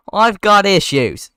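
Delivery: loud, high, drawn-out declaration; hammed-up unhinged outburst. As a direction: announce it like someone losing it. Ken unhinged in acted fight.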